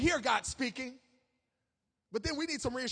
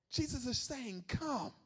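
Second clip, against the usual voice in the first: soft repeat; 7 dB quieter, slower than usual. Real, not acted. Soft repeat after loud call.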